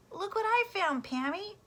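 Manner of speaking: put-on a small child thrilled by her find; little girl's voice. Little Sue shows her find.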